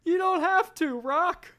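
put-on high-pitched old manager. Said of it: "act it out here".

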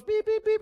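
high, loud electronic beeps; imitated detector beeps. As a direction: sound like a detector going off. Detector going off over perfect family.

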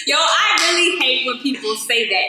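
really fed up, let it out; exasperated outburst. Real, not acted. Venting about pregnancy comments.

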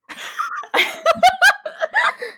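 high-pitched loud burst of laughter; helpless high cackling laugh. Real, not acted. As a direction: crack up loudly, high and long. Laughing at own slip.

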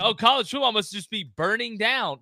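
laughing, hammed-up disbelief; mock outrage. Host mocking overreaction to coaches leaving, laughing mock outrage. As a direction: ham up the fake despair, chuckling. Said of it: "we're acting like".

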